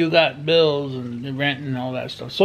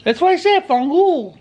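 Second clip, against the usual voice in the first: indignant; 12 semitones higher, 4 dB louder than usual. Real, not acted. Defends his pronunciation.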